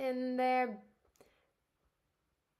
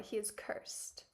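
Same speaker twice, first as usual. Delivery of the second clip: whispered; 9 dB quieter, slower than usual. Acted. Reading ominous game line.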